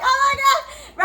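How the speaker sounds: high, slow Oh my God; mock overwhelmed moan. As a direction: act overwhelmed in bed. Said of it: "you sitting there like".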